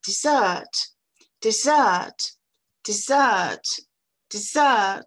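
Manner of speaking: dessert with a tiny first syllable, loud long second; barely touch de-, land hard on -ssert in dessert; accent sur la deuxième syllabe. Shown as a demonstration. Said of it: "la première syllabe est toute petite". (dessert, première syllabe toute petite, seconde forte)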